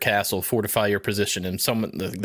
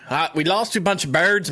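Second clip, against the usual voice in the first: put-on a weak stab at an Australian accent; lightly hammed Australian bloke, higher; 7 semitones higher than usual. Joking about the lost war, failed Australian accent. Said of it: "My, I can't even do it".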